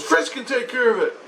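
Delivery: high-pitched imitation of a wife; mock wife brushing off the worry; insistent wife. Wife answers husband about the dog, high insistence. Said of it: "she goes".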